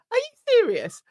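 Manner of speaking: high, incredulous outburst. Acted. Author reacting to paid bookshop placements, incredulous. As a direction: ask it in high-pitched disbelief. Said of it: "but I still feel like".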